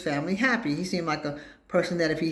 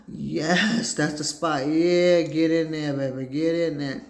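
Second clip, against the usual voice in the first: hammed-up blissful cries, louder on yeah; mock ecstatic urging; 3 dB louder, slower than usual. Patient urging the hygienist on, mock ecstatic. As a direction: urge her on like it's heaven. Retold bliss during dental scraping.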